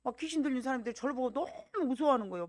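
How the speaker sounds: ordinary delivery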